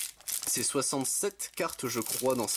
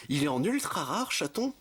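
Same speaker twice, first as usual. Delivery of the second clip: real surprise, pitch leaping up at the end; surprised; 5 semitones higher than usual. Real, not acted. Collector finding his card in ultra rare, surprised. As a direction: ask it high, in disbelief.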